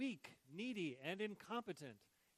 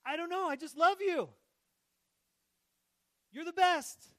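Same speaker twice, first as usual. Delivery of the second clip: high, loud, bursting with love; gushing; 13 semitones higher, 17 dB louder than usual. Pretend. Answering the spouse who asks why, high loud gushing. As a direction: blurt it out overflowing.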